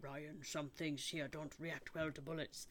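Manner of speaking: put-on muttered low Scottish accent, Connery style. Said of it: "so it's a Scottish accent".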